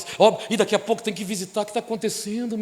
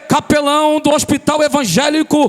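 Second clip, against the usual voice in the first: loud, high, fervent preaching over music; full-force preaching; 7 semitones higher, 7 dB louder than usual. Acted. Pastor proclaiming to the church, loud and fervent. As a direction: preach it out to the congregation.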